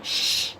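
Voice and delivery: a mock shushing hiss; imitated shushing. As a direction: shush him the way he did it. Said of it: "well, he kept on".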